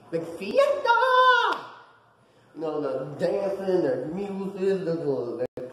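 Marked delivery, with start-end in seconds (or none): 0.1-1.6 high, drawn-out fiesta cry; whooping party shout, much higher; festive cheer. Retold or imitated. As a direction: belt it out like a party announcer, long held last vowel. Hyping the party in Mexico.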